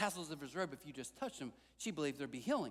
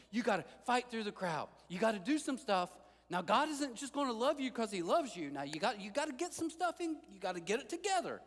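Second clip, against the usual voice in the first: louder, higher mock hustle sermon; mocking pushy preacher; 7 semitones higher, 4 dB louder than usual. Pastor mocking American Christianity, loud pushy mock preaching. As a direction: parody a works-based preacher. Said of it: "the American version of Christianity we love".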